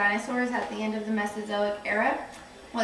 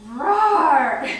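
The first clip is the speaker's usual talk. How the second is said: dinosaur roar; 3 semitones higher, 8 dB louder than usual. Acted. Dinosaur bursts in roaring.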